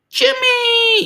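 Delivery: high, loud joyful call of a name; thrilled shout to a friend joining the stream; excited shout. Real, not acted. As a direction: call out Jimmy with delight. Greets a viewer joining the stream.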